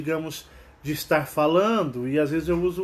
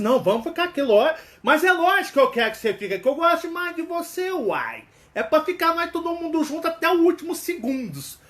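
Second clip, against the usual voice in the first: higher, fast and eager; secretly eager mineiro; 9 semitones higher than usual. Retold or imitated. Mineiro's inner voice begging a friend to stay, higher and fast. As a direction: blurt out what he really wants. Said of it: "no fundo ele tá assim".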